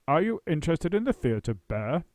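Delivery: loud actor boom. A narrator's reading. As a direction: boom the question. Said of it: "he boomed".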